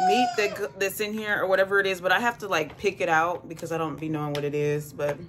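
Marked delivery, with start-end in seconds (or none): 0.1-0.6 giggling while talking; words broken by laughter; laughing through words. Real, not acted. Laughing mid-sentence.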